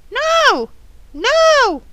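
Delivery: high parrot echo. Acted. Parrot echoing "you may not", high parrot voice. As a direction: squawk each word high, one by one.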